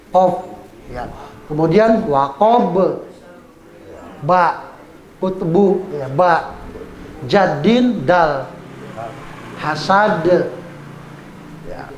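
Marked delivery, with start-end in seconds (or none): 2.1-3.0 "wakob" with a bounced final b; qalqalah. Shown as a demonstration. Teacher showing ba, echoed b in "wakob". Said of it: "harus dibaca qalqalah". 9.6-10.2 "hasad" with a bounced final d; qalqalah. Shown as a demonstration. Teacher showing dal, echoed d in "hasad". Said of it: "harus dibaca qalqalah".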